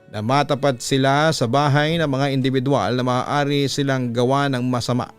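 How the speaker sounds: ordinary delivery, nothing marked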